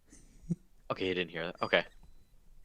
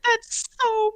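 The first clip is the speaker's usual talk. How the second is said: mock crying. Squeaky character reacts to being talked about, mock crying. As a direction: wail it like a wounded cartoon mouse. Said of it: "I don't know why you're crying".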